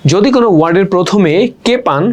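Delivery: usual speaking voice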